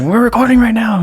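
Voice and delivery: high-pitched movie-star impression, joking; light, high comic quote; high mock Jackie Chan voice.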